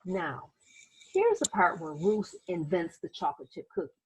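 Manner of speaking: put-on gentle read-aloud narration for children; teacher reading a picture book aloud; story narration. A narrator's reading. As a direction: tell it like a bedtime storybook.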